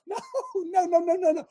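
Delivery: ordinary talk, nothing marked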